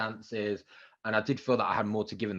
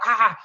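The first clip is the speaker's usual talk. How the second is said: high, loud re-enacted scream; retold scream; 12 semitones higher, 10 dB louder than usual. Presenter reliving the Strictly news in the street, screaming. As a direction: scream out as if just given amazing news. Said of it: "I was screaming in the street".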